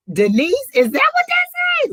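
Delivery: loud, sky-high squeal of disbelief; incredulous shriek. Real, not acted. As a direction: shriek the question in utter astonishment. Finally deciphers the unreadable name.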